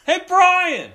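high, loud repeated shouts, hammed up; dad calling out. Dad hunting for Brian in the cafeteria, hammed-up calling out. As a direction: holler for your kid across a room.

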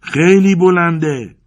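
long, high, full shout; frightened yell. A narrator's reading. Terrified climber shouting up to his friend, yelling. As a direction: yell it slowly at the top of your voice. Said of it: "دوباره داد زدم".

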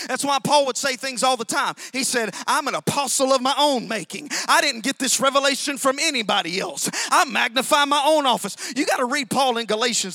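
loud, driving preacher's cadence; full-force preaching. Acted. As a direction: preach it out to the whole room. Preaching Paul's boldness.

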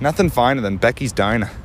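playful mock Boston accent. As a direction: recite it like a Boston local. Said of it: "You get the Boston voice".

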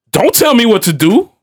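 high-pitched retold teenage defiance; defiant stepdaughter. Stepdaughter talking back to her stepfather, mimicked defiance. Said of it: "she said it with that".

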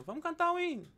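a light church-lady voice calling out the hymn; irmã da igreja anunciando. Retold or imitated. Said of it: "a irmã falou assim". (imitando a irmã da igreja, bem mais agudo)

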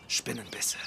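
Flüstern. Acted. Ominous reveal of death cause.

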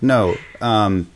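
ordinary talk

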